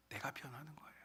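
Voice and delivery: hushed, confiding whisper; whispered secret to the congregation. Real, not acted. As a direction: lean in and whisper the point. Key point dropped to whisper.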